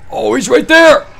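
terrified shout. Real, not acted. Spots the monster.

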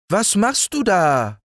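put-on high little boy's voice, calling upward; child calling up. Little Häwelmann calling up to the rooster, high child voice. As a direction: call up to the rooster like an eager small boy. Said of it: "rief der kleine Häwelmann hinauf".

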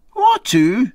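a sharp high question; quite sharp. Acted. Wife doubting the captain's engagement, asks sharply. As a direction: snap the question back, doubting him. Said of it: "quite sharp".

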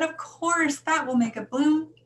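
high, loud, thrilled certainty; excited realization. A narrator's reading. Girl telling the librarian it will bloom, excitedly. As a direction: burst out with the solution.

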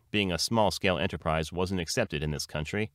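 usual speaking voice

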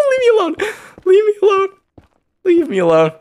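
terrified plea to the mobs; panicked shriek. Real, not acted. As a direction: scream at them to back off. Fleeing attacking piglins.

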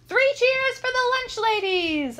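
high, loud, drawn-out rallying cry; shouting. A narrator's reading. Pupil rallying the assembly for the lunch ladies, shouting. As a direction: call for cheers across a hall. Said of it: "I shouted".

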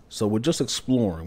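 ordinary talk

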